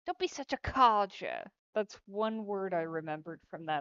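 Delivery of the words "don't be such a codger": louder mock-British scolding; put-on British accent, punched out; hammy British telling-off; British insult. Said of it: "fifty British insults".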